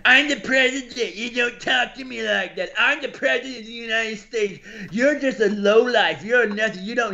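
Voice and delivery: put-on high-pitched childish president tantrum; hammed-up petulant whine; Trump. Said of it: "he's such a child".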